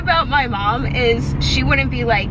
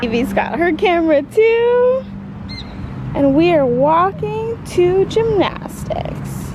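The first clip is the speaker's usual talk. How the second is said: excited singsong; 10 semitones higher, 3 dB louder, slower than usual. Real, not acted. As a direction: announce it high and excited. Excited vlogging with small child.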